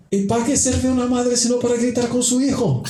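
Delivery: high mock-indignant mother. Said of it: "la mujer dijo".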